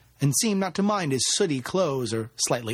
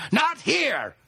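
high-pitched frantic warning; escalated cry, pitched way up; panicked shout; 13 semitones higher than usual. A narrator's reading. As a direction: shout it in desperate alarm. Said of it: "he shouted".

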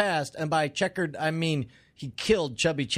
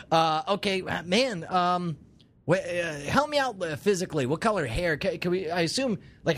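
ordinary delivery

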